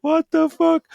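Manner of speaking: very high, loud, shocked yelp; shocked shout. Retold or imitated. Boyfriend reacting to the monster in the bathroom, shrill shocked yell. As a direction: yelp it like you've just seen a monster. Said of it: "Just like".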